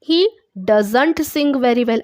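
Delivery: very slow, each word spaced out; slow dictation. Shown as a demonstration. Teacher dictating an English sentence to her class, very slowly. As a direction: read it out slowly for students to follow.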